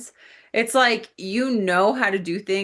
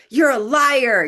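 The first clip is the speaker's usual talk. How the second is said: loud, higher accusing jab; mock accusation; 6 semitones higher, 6 dB louder than usual. Imagining confronting someone, a loud mock accusation. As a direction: blurt out the accusation like an outburst. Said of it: "You're not coming out and being like".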